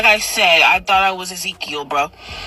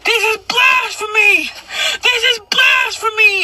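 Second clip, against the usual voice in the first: high-pitched, drawn-out outraged yell; slower than usual. Retold or imitated. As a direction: cry it out like a frantic prophet denouncing a crowd. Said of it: "I started yelling".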